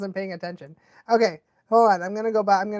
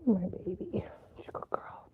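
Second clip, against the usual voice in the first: soft whisper to the dog; whispered praise; 3 semitones lower, 14 dB quieter than usual. Real, not acted. Owner whispering praise to the dog.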